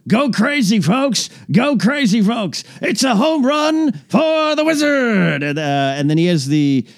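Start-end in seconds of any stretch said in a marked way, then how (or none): 0.1-5.4 high, excited play-by-play, slow on the last line; Jack Buck call. Retold or imitated. Recreating Jack Buck's famous home run call, high and excited. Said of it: "that's the Jack Buck one".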